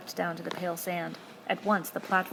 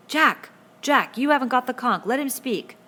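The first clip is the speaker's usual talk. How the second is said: higher, louder call to stop him; urgent shout; 7 semitones higher, 9 dB louder than usual. A narrator's reading. Ralph shouts at Jack to let Piggy speak. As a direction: shout him down, pitch raised.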